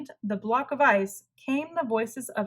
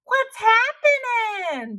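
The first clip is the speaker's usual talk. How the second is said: much higher, louder, slow booming call; blared; 13 semitones higher, 6 dB louder, slower than usual. Acted. Tacky blares at the hunters, slapping one's back. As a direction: blast it out cheerfully. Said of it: "blared Tacky".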